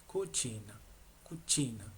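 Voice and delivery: cucina said koo-CHEE-na; Italian pronunciation. Shown as a demonstration. Teacher models the Italian C I for learners, careful pronunciation. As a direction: give cucina a ch sound on the second syllable. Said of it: "se pronuncia chi".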